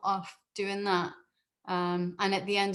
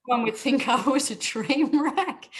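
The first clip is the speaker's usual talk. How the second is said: laughing; 5 semitones higher, 8 dB louder than usual. Real, not acted. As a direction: say it through loud, high laughter. Laughs at her teenage self.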